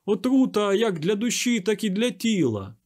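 high, loud outcry; impassioned warning. A narrator's reading. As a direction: cry out to save the crowd. Savage cries out his warning.

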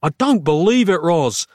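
high, mild mock scream; exasperated scream. A narrator's reading. Narrator to Roz over wrong batteries, screaming mildly. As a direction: exclaim in disbelief. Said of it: "I screamed".

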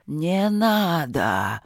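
very slow rumbling inhuman voice; guttural fire voice. Acted. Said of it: "голос был утробный, как гул сильного огня".

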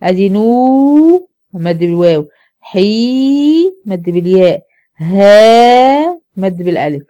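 نو حي ها each vowel drawn out slowly; stretched syllables. Shown as a demonstration. Teacher breaking the word apart for her class, slow and stretched. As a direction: hold each long vowel of نوحيها as you name it. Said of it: "هي كلمة نوحيها".

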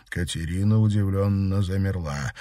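ordinary delivery

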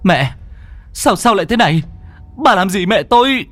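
high, strained shouted demand; roaring in alarm. A narrator's reading. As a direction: cry out in outraged panic, stumbling at first. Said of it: "Quân gầm lên". (gầm lên phẫn nộ)

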